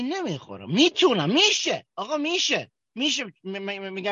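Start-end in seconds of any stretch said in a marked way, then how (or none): 0.7-3.2 high, insistent "it's possible"; emphatic insistence. Real, not acted. Speaker at a recovery meeting insisting it can be done, emphatic. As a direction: press the point home eagerly.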